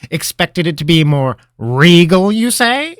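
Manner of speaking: high, loud, playful wizard voice; teasing old wizard. Acted. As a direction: tease him like a mischievous mentor. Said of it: "asked with a twinkle in his eye".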